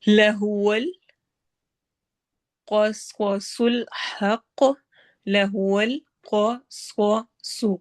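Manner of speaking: slow chanted tajweed with a long drawn ha; Quranic recitation. Shown as a demonstration. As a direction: recite it, stretching the vowel. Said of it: "تینوں پر heavy کر کے پڑھنا".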